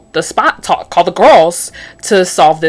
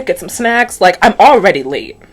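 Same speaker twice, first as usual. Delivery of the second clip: normal talking voice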